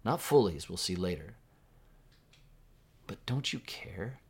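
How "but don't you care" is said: softer, higher plea, lightly acted; sense of abandonment. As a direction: gently voice a mild feeling of being abandoned. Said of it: "It's that sense of abandonment".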